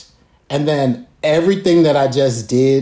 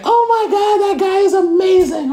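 excited fan; 14 semitones higher than usual. Retold or imitated. Fans in the audience cheering for him, shouting high. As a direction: squeal like a thrilled fan. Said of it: "people in the audience just like".

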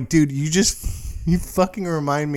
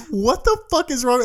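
pitched way up, alarmed; high startled what the fuck; alarmed question; 10 semitones higher than usual. Retold or imitated. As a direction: squeak it out in alarm. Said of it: "I'm like".

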